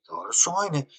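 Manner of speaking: imitating boss. Boss repeats the demand.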